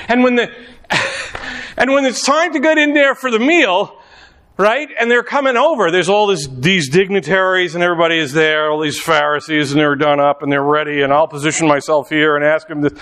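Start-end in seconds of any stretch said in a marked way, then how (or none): none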